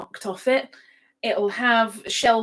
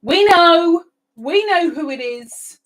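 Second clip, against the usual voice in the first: viewers' voices, higher and much louder; playful loud shout, fading on the second phrase; mock chorus shout; 8 semitones higher, 10 dB louder than usual. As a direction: shout it like the whole chat at once. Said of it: "They're like".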